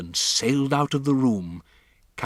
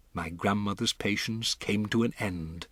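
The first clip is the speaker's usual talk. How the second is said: ordinary delivery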